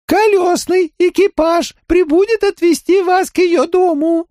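very high monotone alien voice; high, flat and mechanical, like a crab creature. Acted.

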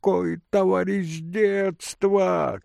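high, drawn-out incredulous question; indignant outcry; old man shouting. A narrator's reading. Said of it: "кричал старик". (старик кричит, возмущённо и протяжно)

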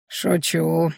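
low, offhand little smirk. A narrator's reading. Innkeeper's friend admitting she was joking, smirking. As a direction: shrug it off as a joke. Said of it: "хмыкнула она".

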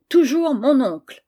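angry exclamation. A narrator's reading. Angry girl complaining about her uncle, indignant cry. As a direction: cry out in childish exasperation. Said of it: "s'écria Gisèle".

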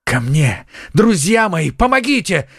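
urgent high-pitched call to friends; crying for help. A narrator's reading. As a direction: call out in mounting panic. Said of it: "закричал Д'Артаньян".

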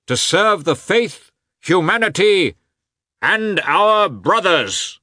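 high, loud, slow speech to volunteers; rising oratory. A narrator's reading. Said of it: "raising his voice more and more".

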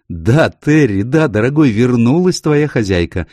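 happy owner coming home; excited greeting. Acted. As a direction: greet your dog joyfully through the door. Owner calls to her yelping dog.

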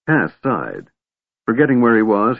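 usual speaking voice

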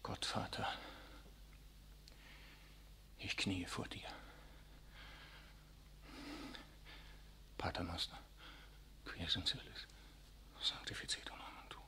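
breathy, hushed prayer alone at night; whispered prayer. Acted. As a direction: murmur the Latin prayer under your breath.

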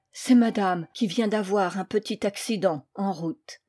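ordinary delivery

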